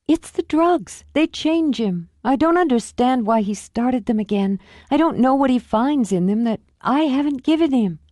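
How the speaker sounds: put-on high, pained young wife; a wife making excuses for him; anguished excuses; Julie, distraught. Julie defends husband.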